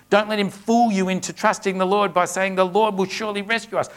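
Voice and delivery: scripture read as an enemy's loud, bold taunt. Acted. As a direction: call it up to the wall with swagger. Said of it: "louder and with more boldness".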